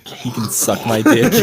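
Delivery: loud, breaking into laughter; laughing. Real, not acted. Joking with friends about Adam, loud and laughing. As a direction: blurt it through a real laugh.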